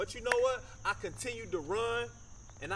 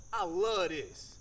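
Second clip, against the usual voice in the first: ordinary talk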